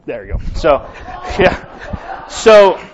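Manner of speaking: ordinary talk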